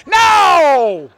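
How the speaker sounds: high, loud single shout; wife shouting no. Retold or imitated. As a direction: bark it out to stop him.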